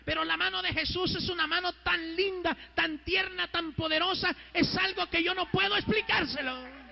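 fervent testimony, building in pitch; sustained preacher's cadence; impassioned preaching. Real, not acted. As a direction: testify to the congregation with rising fervor.

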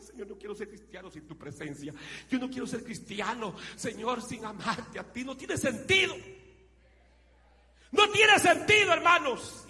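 rising to a high, loud plea; retold tearful prayer. Preacher reliving his prayer to God, building to a loud plea. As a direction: cry out to the Lord, building up. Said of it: "yo lloraba y decía".

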